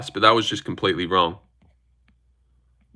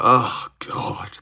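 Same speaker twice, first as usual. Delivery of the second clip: breath-only sighed groan; imagined exasperation; 4 dB quieter than usual. Retold or imitated. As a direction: sigh it out as if fed up. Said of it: "they're just like".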